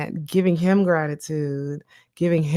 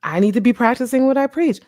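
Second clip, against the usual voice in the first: quick, a bit louder, self-scolding; self-reminder; 6 semitones higher, 4 dB louder, faster than usual. Retold or imitated. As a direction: catch yourself and tell yourself off, lightly. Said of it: "I'm just like".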